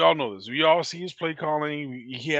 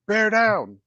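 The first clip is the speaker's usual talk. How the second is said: imitating a yell, higher and louder; retold yell; 7 semitones higher, 5 dB louder than usual. Fan retelling a player's exit from the podium, mock yell. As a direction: give a mock yell of a rallying cry. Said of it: "he yells".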